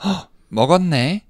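gasped, high, loud, quick discovery; exclaiming to son. Acted. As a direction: burst out in Korean with delighted discovery. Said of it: "I would say".

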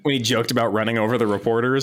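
ordinary talk

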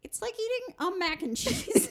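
high, eager agreement; excited agreement. Real, not acted. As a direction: jump in with delighted agreement.

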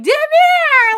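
high, loud scandalised cry of his name; playfully scandalised; mock shocked shout. She protests his flirting.

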